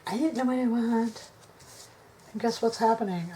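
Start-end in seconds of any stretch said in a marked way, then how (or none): 0.1-1.3 mock whiny indecision. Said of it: "I don't wanna spend my whole video going".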